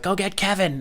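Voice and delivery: high mock voice of the lady; put-on lady's voice. Fallen lady on the ice sending him for help, high mimicking voice. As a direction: imitate her in a high-pitched voice.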